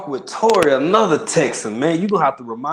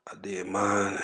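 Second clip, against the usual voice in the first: whisper; 5 semitones lower, 6 dB quieter, slower than usual. Real, not acted. Whispered aside.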